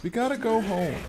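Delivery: put-on high, young lion cub voice; a lightly voiced young Simba pleading. Gamer to his fallen character, young Simba voice. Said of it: "And my Simba voice".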